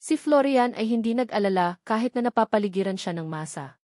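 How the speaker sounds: ordinary delivery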